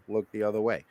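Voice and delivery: soft, low echo of the last words; echoed repeat. Shown as a demonstration. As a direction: drop it like a fading echo. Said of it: "There's your opening".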